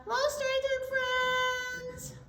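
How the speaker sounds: high, slow puppet voice; Zebra puppet voice. Pretend. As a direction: greet the kids as a silly zebra. Host voicing Zebra puppet.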